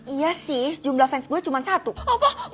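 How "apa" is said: a sharp high shocked Apa. Acted. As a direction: jolt up in disbelief on Apa. Shocked at one fan. (kaget, nada tinggi)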